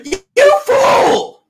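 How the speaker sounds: high-pitched, drawn-out shout; mock outraged shout. Mock outburst at game contestant.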